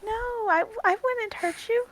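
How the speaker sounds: high, soft soothing voice; gentle reassurance. Acted. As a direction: reassure a frightened little animal. Character soothing scared monkey.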